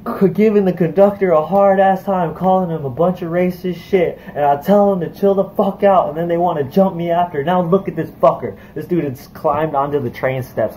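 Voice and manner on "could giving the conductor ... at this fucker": raised, higher-pitched retold rant, slowed at the start; retold screaming. Yelling at the conductors and bystanders, in a partly recreated, raised voice. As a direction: hammer the words out like the fight is still on. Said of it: "I was just started screaming at everybody".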